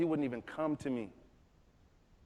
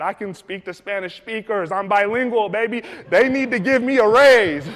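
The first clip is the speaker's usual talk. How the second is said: loud, excited boasting; acting out a cocky brag; bragging to wife; 5 semitones higher, 13 dB louder than usual. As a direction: build to a triumphant, higher-pitched finish.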